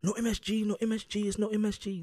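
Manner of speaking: high, loud, insistent mock denial; a hammed-up cook swearing there's no MSG; mock restaurant worker. Mocking the Chinese restaurant staff insisting, high and loud. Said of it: "they start tripping".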